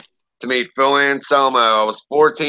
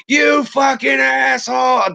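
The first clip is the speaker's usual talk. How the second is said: screaming fan; 12 semitones higher, slower than usual. Retold or imitated. Fourteen-year-old screaming at singer.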